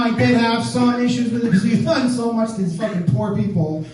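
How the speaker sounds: ordinary talk, nothing marked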